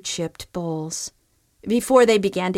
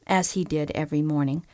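plain reading voice

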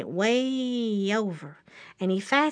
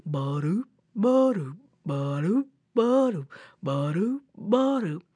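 sauntering footstep noises. Acted. Old bear saunters down the road, seesawing footstep sounds. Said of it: "a-sauntering along, going".

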